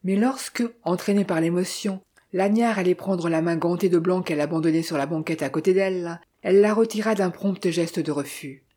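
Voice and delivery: ordinary delivery, nothing marked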